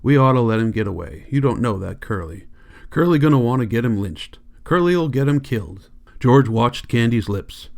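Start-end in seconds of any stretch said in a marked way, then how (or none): none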